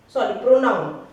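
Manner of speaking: usual speaking voice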